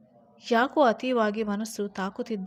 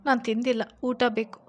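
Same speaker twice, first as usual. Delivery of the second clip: sulky plea; 9 dB louder than usual. A narrator's reading. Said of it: "ಮುಖ ಸಪ್ಪೆ ಮೋರೆ ಹಾಕಿ".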